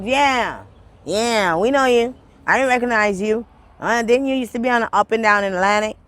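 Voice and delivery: high, loud knowing officer; accusing officer. Retold or imitated. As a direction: imitate an officer who has you figured out. Voicing officer who claims recognition.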